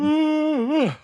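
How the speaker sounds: long, high-pitched yawn; real yawn. Real, not acted. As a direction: let out a big yawn that falls at the end. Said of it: "now that I'm done yawning".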